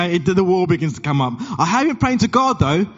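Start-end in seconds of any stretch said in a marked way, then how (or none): 1.6-2.9 insistent. Retold or imitated. Acted quote of defensive friend in argument.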